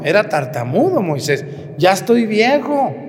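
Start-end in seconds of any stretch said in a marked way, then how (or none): 1.8-2.9 pleading Moses. Retold or imitated. Moses objects to God.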